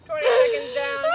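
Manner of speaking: straining shout mid-exercise; strained shout. Real, not acted. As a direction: call it out through the burn. Strained shout during wall sit.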